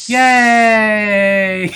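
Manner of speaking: loud happy yay; excited cheer. Acted.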